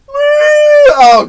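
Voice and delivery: high wailing oh; mock anguished wail. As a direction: wail it like a heartbroken kid. Mock anguish over dropped snacks.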